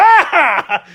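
high loud startled "Ah!"; child's frightened shout; startled yell. Retold or imitated. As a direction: yelp like a scared kid. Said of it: "I was like".

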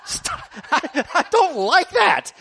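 wife fending off her husband's wrestling, high and laughing; wife protesting. Retold or imitated. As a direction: play the wife pushing him off, half laughing. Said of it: "She's like".

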